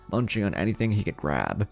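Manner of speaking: plain reading voice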